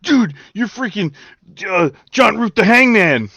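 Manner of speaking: high, loud mock fan gushing; hammed-up awestruck yell; starstruck fan. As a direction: blurt it out like a pretend superfan. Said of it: "I would be like".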